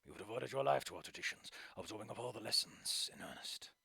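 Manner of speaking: low, soft and gentle; calm a nervous granddaughter; soothing grandfather. Acted. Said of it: "his once bombastic tone is now more soothing".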